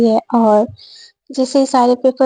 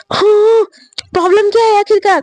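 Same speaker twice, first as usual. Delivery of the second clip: high, tearful outburst; crying frustration; 8 semitones higher than usual. Retold or imitated. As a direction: near tears, demanding an answer. Sakshi breaks down, cries. (रोते हुए ऊँची आवाज़ में पूछना)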